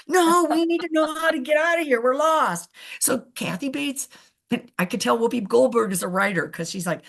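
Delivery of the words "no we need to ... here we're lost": higher, louder mock-desperate yell; exasperated shout. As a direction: shout it out, fed up and lost. Whoopi shouting she's lost.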